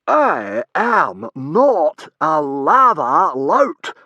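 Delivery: very slow, loud, high howl; howled denial. A narrator's reading. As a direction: howl each word as loud as you can. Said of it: "howled the man as loud as he could".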